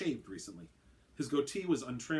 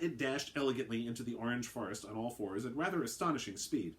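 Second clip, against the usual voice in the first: usual speaking voice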